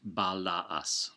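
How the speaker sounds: "balaas" said ba-la-as, the two A's as separate syllables; separate A sounds. Shown as a demonstration. Teacher demonstrating the split A's of "balaas" to learners, slow and clear. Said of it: "those A's, uh, at the end are pronounced separately".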